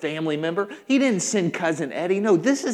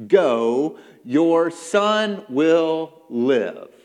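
loud, very slow, weighty pronouncement; booming, drawn-out command; commanding declaration; 6 dB louder, much slower than usual. Acted. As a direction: proclaim each word separately at full voice. Preacher repeats Jesus' words forcefully.

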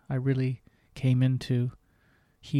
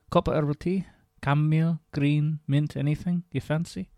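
put-on Diana's Scottish accent; 2 semitones higher than usual. Diana listing teas for her guest, in a mild Scottish accent. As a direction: rattle off the tea choices in a homely Scottish voice. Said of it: "my own version of a Scottish accent".

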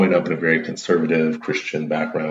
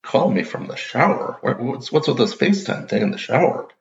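higher, amused dad voice; dad laughing question; 7 semitones higher than usual. Retold or imitated. Dad asking why his son calls from the shower, higher and amused. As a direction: ask it like a baffled, amused father. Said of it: "And he's like".

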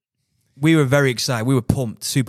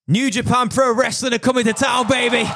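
booming wrestler boasts; 12 semitones higher than usual. Real, not acted. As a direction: yell it like a ring announcer. Big announcement, shouting hype.

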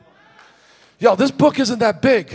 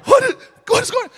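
shocked disbelief; 10 semitones higher than usual. Retold or imitated. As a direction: blurt it out in total shock. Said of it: "I'm like".

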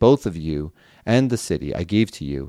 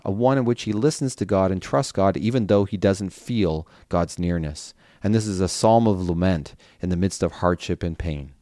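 usual speaking voice

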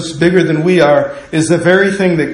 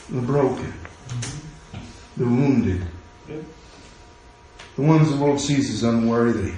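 softer, lower sermon voice over music; hushed preaching; 7 semitones lower, 5 dB quieter than usual. Acted. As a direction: draw the congregation in quietly.

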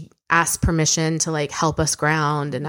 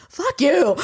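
playful retort; 11 semitones higher than usual. Retold or imitated.

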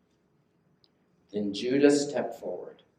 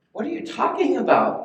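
indignant protest; 13 semitones higher, 5 dB louder than usual. Acted. Brothers protest the accusation.

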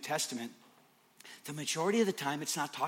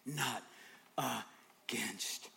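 whisper; 4 semitones lower, 9 dB quieter, slower than usual. Acted. Intimate sermon moment.

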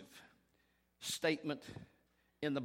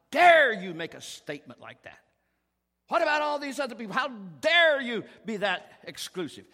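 loud, higher outraged retold yell; indignant shout; 6 semitones higher, 11 dB louder than usual. Young man who climbed the pews, shouting at the preacher in outrage. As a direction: hurl the accusation up close.

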